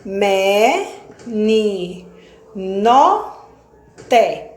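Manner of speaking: "Pé, te, ca" sílabas destacadas, com pausas; silabando. Shown as a demonstration. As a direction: bata cada sílaba de peteca. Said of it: "mais de quatro sílabas". (separando sílabas para a turma)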